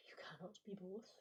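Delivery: whispered teasing aside, voice gone to breath; joking whisper. Pretend. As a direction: lean in and breathe the joke out. Joking aside after laugh.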